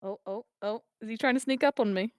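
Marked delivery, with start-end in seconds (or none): none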